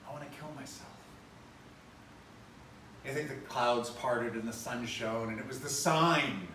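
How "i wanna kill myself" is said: quiet confession. Retold or imitated. Suicidal caller voiced in retold show.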